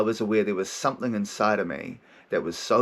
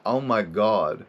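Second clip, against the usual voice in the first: slow dawning realisation; 3 semitones higher, slower than usual. Retold or imitated. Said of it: "when you're like".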